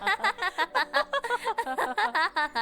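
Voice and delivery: high soft giggling laugh; high giggle. Acted. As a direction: giggle together at the joke. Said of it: "broke into laughter".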